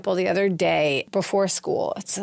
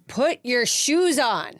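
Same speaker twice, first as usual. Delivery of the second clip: higher, slow, raised put your shoes on; 6 semitones higher, slower than usual. Shown as a demonstration. Parent raises voice to get kids' shoes on, higher and slower. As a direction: demo of raising your voice. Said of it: "raise your voice".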